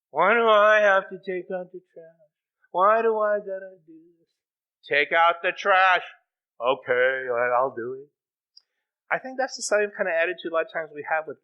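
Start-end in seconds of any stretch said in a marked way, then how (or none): 0.1-4.3 hammed-up childish complaint; whining kid. Acting kid protesting a chore. 4.9-6.0 slow, loud parental order; acted stern repeat, each word stretched; insistent parent. 6.6-8.1 low, reluctant mock surrender; acted grudging okay, pitched down; grudging compliance. Acted kid giving in reluctantly.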